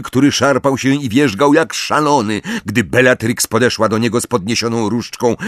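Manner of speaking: usual speaking voice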